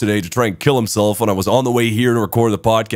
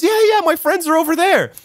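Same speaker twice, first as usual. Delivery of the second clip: much higher-pitched eager chirp; imitated excited woman. Drunk woman points out her friends, imitated excited high voice.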